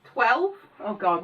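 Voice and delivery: usual speaking voice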